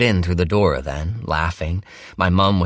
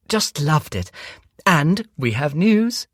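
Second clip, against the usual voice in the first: gushing mother; 11 semitones higher, 3 dB louder, slower than usual. A narrator's reading. Mother echoes Ted.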